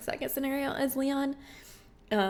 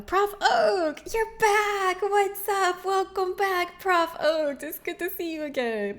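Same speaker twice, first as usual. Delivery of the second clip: excited greeting; 7 semitones higher, 3 dB louder, slower than usual. Real, not acted. Greeting returning chatter excitedly.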